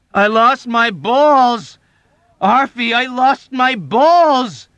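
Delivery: very high, loud, slow anguished scream. Acted. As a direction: scream in terror for help. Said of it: "screamed at Arfie for help".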